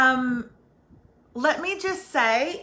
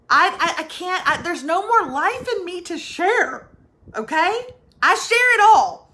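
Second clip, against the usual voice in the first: exasperated protest; 5 semitones higher, 6 dB louder than usual. Real, not acted.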